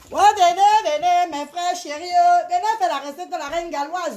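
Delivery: loud, high sales call; market-stall cry. Real, not acted. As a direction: hail the crowd of buyers from afar. Seller calling out to buyers.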